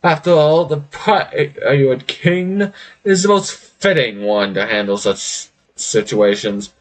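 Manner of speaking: drawn-out, hammed-up boastful pirate; amused Pirate King. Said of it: "Pirate King just laughs and is like".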